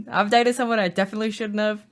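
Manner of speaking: ordinary talk, nothing marked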